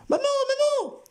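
put-on very high child's scream for mum; screaming girl. A narrator's reading. Girl screams for her mother as the man grabs her. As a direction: cry out for your mother in terror. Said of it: "qui se mit à hurler".

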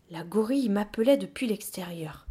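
ordinary delivery

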